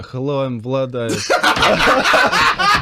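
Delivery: loud put-on Russian accent. Trainer joking about consultations, hammed-up Russian accent. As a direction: ham up a Russian accent, breaking into laughter. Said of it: "I'm just gonna pull out the Russian accent".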